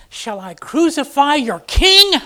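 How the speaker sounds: high, loud, slow question; incredulous. Acted. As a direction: demand it of the crowd. Pilate questions the crowd.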